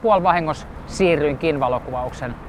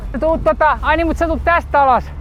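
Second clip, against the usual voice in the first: raised, high-pitched calling voice; calling out directions; 11 semitones higher, 3 dB louder than usual. Real, not acted. Photographer directing a skater on the wall, calling out high and loud. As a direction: call it across to someone at a distance.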